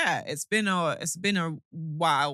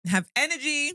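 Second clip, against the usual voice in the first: excited; 9 semitones higher, 4 dB louder than usual. Acted. Coaxing her friend to perk up.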